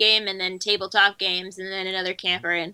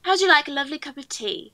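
quick put-on English accent, a touch higher; 5 semitones higher, faster than usual. Friends asked for her English accent, she answers in English accent. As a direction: repeat the line in your best English accent. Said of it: "Can we, uh, hear your best English accent?".

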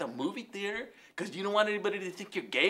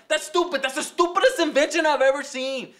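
loud, higher-pitched comic outrage; exasperated rant; 5 semitones higher, 6 dB louder than usual. Acted. Paco fed up with toilet sensors, exasperated rant. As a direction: rail at it in hammed-up indignation.

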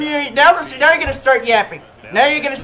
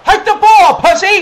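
loud, high-pitched heckle; impatient trash-talk yell; taunting shout; 6 semitones higher, 5 dB louder than usual. Real, not acted. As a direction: goad the opponent with a raised voice. Taunting opponent during play.

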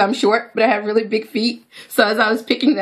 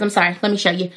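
ordinary talk, nothing marked

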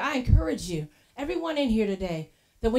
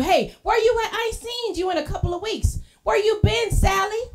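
high, loud friendly call; hey there call-out; 9 semitones higher, 7 dB louder than usual. Pretend. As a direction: call out to a friend you miss. Calling out to a friend.

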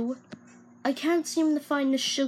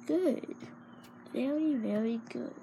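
sinister soft praise; 10 dB quieter than usual. Acted.

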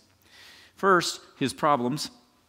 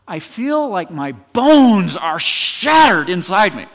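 loud, slow, higher-pitched mock agony; hammed-up anguish; 5 semitones higher, 11 dB louder, slower than usual. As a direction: belt it out like an overdramatic kid after school. Acts out child's overblown answer.

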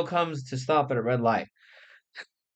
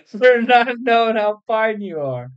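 loud catcall; 7 semitones higher, 5 dB louder than usual. Acted. Afro Man catcall continues.

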